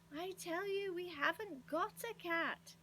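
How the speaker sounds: high, slow, insistent protest; quite cross. A narrator's reading. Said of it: "feeling quite cross".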